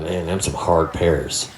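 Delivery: low, slow folksy local voice. Retold or imitated. Local people remarking on old yard pears, low and slow. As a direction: play a slow old-timer marveling at the pears. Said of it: "And people will be like".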